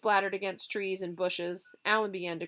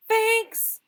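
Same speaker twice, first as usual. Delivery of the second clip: high yell from a distance; shouted; 11 dB louder than usual. Acted. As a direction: shout it out the window. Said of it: "a boy shouted".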